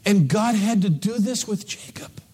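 hushed preacher whisper; whispered sermon line. Real, not acted. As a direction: drop to a whisper for weight.

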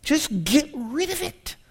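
high, soft, slow plea to take it away; mock anguished prayer; pleading prayer. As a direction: beg God quietly to lift the burden. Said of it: "or remove".

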